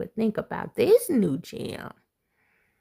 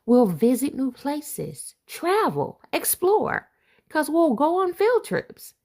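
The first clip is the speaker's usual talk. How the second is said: lively picture-book read-aloud; reading to children; 3 semitones higher, 4 dB louder than usual. Acted. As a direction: read it like a bedtime rhyme to kids. Reads rhyming picture book aloud.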